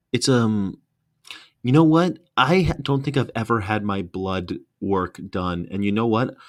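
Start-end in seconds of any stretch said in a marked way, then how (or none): none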